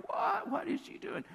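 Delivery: high-pitched mock disbelief; stunned. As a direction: act out a shocked onlooker, voice shooting up. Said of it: "stunned, like".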